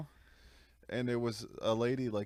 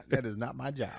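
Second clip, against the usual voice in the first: mock lazy clerk; 3 semitones higher, 4 dB louder than usual. Friends mocking the lazy cashier. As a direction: brush it off like a bored worker.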